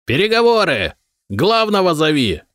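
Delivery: high calling shout across the wall; high hail to the city wall. A narrator's reading. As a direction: yell up to the wall guards. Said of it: "Он орал".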